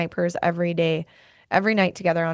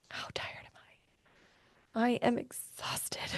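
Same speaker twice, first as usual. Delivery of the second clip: breathy whispered confession; a slow exhausted whisper; 5 semitones higher, 13 dB quieter, much slower than usual. Real, not acted. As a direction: whisper it slowly, as if admitting it to yourself. Self check-in, answering herself.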